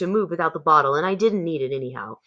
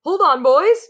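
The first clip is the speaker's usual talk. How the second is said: high, loud alarmed cry; panicked cook; 10 semitones higher, 5 dB louder than usual. A narrator's reading. Cook with a mouse up his trousers calls for help, panicked. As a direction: call the boys for help in panic.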